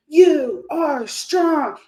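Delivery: retold shouting. Mother cheering her son on a bike ride, retold shouting. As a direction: stretch each word out like a loud cheer. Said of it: "shouting these things".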